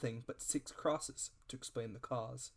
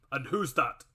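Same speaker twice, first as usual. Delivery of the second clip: loud, sharp challenge; squire crying out; 7 dB louder than usual. Acted. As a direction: demand an answer. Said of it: "cried the squire".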